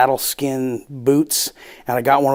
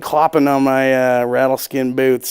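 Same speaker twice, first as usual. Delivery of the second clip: usual speaking voice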